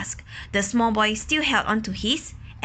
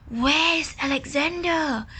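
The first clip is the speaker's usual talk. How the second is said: worried mother duck; 5 semitones higher than usual. Acted. Mother duck calling for duckling.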